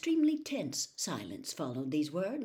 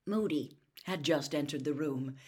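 usual speaking voice

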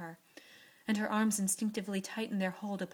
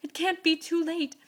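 much higher, loud, "can't" hammered; desperate cry; 10 semitones higher, 10 dB louder than usual. A narrator's reading. Joe insists to Beth it is not too late, loud desperate cry. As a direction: shout it against despair. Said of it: "cried poor Joe rebelliously".

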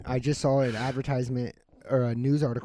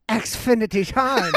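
slow, higher, louder drawn-out slogan; mock announcer; 9 semitones higher, 8 dB louder, much slower than usual. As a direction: stretch it like a sarcastic jingle. Mocking slow service.

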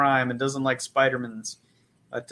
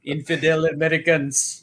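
hammed-up fake Middle Eastern accent; mock Middle Eastern accent; 2 semitones higher than usual. Shown as a demonstration. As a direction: put on a bad movie Middle Eastern accent. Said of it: "I've got written down Tusken Raider".

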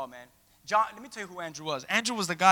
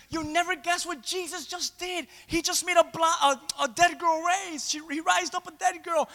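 higher, slowed, wide-eyed telling; excited Peter; 8 semitones higher than usual. Retold or imitated. Peter bragging to Andrew about the miracle, high and thrilled. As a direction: savour the news. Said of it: "He goes".

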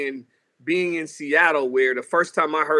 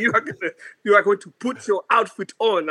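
higher-pitched African-accented mom impression; African mother's accent; 5 semitones higher than usual. Mom telling her child to dress for stage, African accent.

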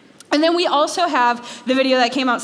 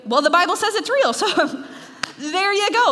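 higher-pitched, glib know-it-all; playfully dismissive; mock smug answer; 8 semitones higher than usual. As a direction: toss off a pat answer, half laughing. Mocking an unhelpful pat answer.

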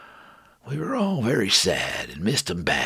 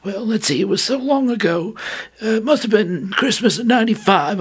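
put-on young soldier voice; 12 semitones higher, 5 dB louder than usual. Lefty's voice held.

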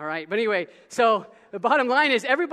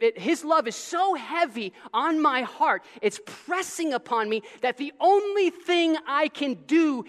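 building passion; 3 semitones higher, slower than usual. Acted. Preaching passion slowly.

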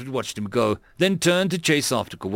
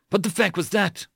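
mild, higher-pitched shout; 7 semitones higher than usual. A narrator's reading. Lieutenant walks up after the shove, restrained shout. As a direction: demand an answer, voice lightly raised. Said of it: "Mayers shouted".

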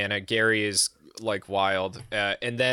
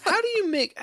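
squeaky mock voice, much higher; mock older person. Older folks asking about YouTube money, squeaky mock voice. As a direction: pitch it way up like a clueless elder.

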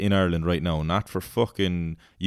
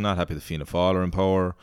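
ordinary talk, nothing marked

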